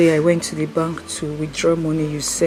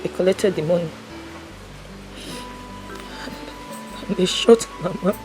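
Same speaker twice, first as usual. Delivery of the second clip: low, soft telling of loss; grieving account; 14 dB quieter than usual. Acted. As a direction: tell of Mama's death quietly. Sad far account of death.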